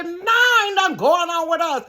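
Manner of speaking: high and loud, offended; indignant denial. Retold or imitated. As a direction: shoot it down hard.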